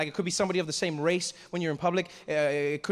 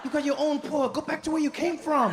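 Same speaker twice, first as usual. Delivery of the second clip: high-pitched acted outrage; indignant telling-off; 8 semitones higher than usual. Local man scolding an unwanted visitor, high and indignant. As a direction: send the intruder packing, pitch raised. Angry host tells visitor off.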